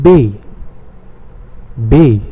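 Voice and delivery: Bay with a long German "eh" vowel; Bay sounded out firmly, long pure vowel; German letter B. Shown as a demonstration. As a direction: say Bay as the German name of B, "beh". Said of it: "Bay in German".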